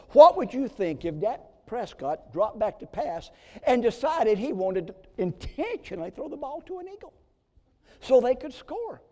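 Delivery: loud, high-pitched preaching; fiery pulpit delivery; preaching at full force. Acted. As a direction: drive the question home to the congregation. Preacher building to a peak.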